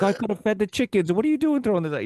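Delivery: high-pitched mock scolding mom; annoyed mom. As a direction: imitate mom fussing over wasted food. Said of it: "she would still get mad at me".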